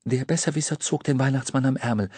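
plain reading voice

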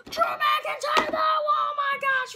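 high, loud commentator yell; excited shout. Acted. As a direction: shout the reversal as it happens. Calling a top rope reversal.

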